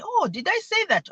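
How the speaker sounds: high, lilting feigned surprise; acted "oh, really?" astonishment; mock surprise. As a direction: play innocent, voice swooping up. Mother feigning surprise to son.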